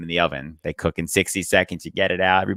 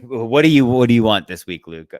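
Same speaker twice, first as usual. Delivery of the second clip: loud, higher shout across the counter; deli clerk yell; 4 semitones higher, 10 dB louder than usual. Retold or imitated. Deli man shouting at young Luke, loud and higher. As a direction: bark the order question at the kid.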